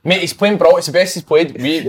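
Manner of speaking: ordinary delivery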